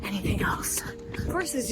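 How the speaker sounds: whispered emphasis. Real, not acted. Serious point about weed.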